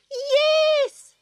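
a mocking old joker; chuckling kookaburra. A narrator's reading. Kookaburra teases the court, high and amused. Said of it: "chuckled the kookaburra".

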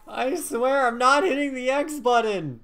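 high, loud insistence; exasperated protest. Real, not acted. Player denies to teasing friend opening the gate, exasperated protest.

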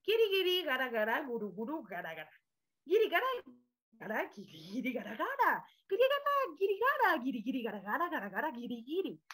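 playful made-up chant of giri and gara; gibberish Guirigay. Shown as a demonstration. Teacher demonstrating gibberish to the class. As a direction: rattle off nonsense syllables in a sing-song. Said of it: "la gente ahí habla así".

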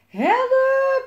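high, long scream for help; screamed cry. A narrator's reading. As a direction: shriek it out, stretched long. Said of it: "gilde Jelmer".

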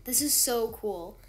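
ordinary talk

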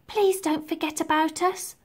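put-on high small-child voice; pleading little girl. A narrator's reading. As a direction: plead gently as the littlest orphan. Littlest orphan pleads with departing friend.

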